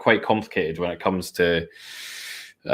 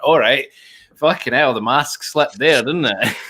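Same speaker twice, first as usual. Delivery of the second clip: higher, wry mock surprise; sarcastic quip; 5 semitones higher than usual. Answering online trolls, a wry sarcastic jab. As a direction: needle them with a knowing jab. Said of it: "And I went".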